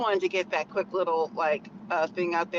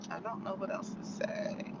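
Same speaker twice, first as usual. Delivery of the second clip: real whisper, much softer; 10 dB quieter than usual. Real, not acted. Vlogger running out of things to tell viewers, whispering. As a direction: drop to a whisper, trailing off.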